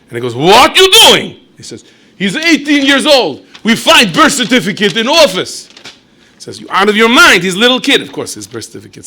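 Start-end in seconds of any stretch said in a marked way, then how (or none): 0.4-5.7 high, loud, slow demanding shout; angry shout. Acted. Soldier and Berish shouting. 6.6-8.0 high, loud, outraged protest; indignant shout. Acted. Commissar protests.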